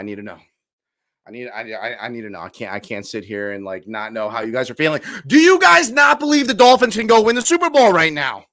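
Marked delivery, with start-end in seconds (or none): 5.3-8.4 loud, high shout at the chat; loud excited fan yell. Real, not acted. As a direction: yell it at the audience in disbelief.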